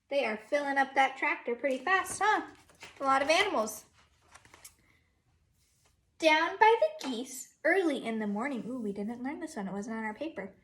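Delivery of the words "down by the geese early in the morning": lively sing-song storybook read, a bit louder; teacher reading Down by the Barn to her class, sing-song; reading to children. Acted. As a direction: read it like a storybook for little kids. Reads picture book aloud.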